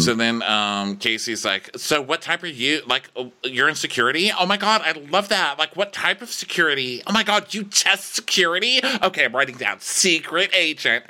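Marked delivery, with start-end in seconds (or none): 1.8-11.1 high, giddy fangirl imitation; hammed-up breathless fascination, pitched up; mock gushing. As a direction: gush like you are hugely impressed. Mimicking Casey gushing over security job.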